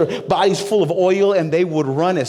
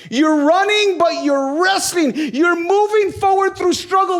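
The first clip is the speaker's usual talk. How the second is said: full-force preaching; 10 semitones higher than usual. Acted. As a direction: preach it like the stakes are everything.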